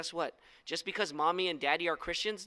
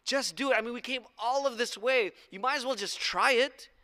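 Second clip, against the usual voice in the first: higher, imploring servant's plea; coaxing crew pleading with their angry leader; 6 semitones higher than usual. Acted. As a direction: beg the master to reconsider. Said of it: "the crew starts pleading with him".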